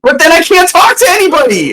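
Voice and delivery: whiny protest. Acted.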